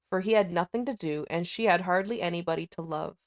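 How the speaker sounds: ordinary delivery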